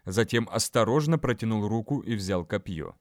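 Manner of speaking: plain reading voice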